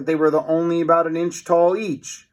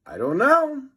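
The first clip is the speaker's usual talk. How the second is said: put-on puzzled boy; 12 semitones higher than usual. A narrator's reading. Second boy.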